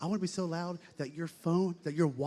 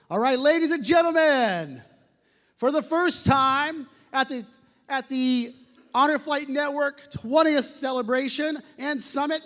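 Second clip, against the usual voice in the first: booming high arena announcer; announcer call; 21 dB louder, slower than usual. Acted. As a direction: boom the name out like a ring announcer. Emcee introduces guest to crowd.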